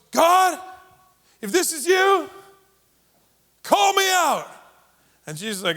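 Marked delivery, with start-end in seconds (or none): 0.1-4.5 high and loud, reaching out; calling out across water. Acted. Peter calling out to Jesus walking on the stormy water. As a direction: call out boldly into the storm.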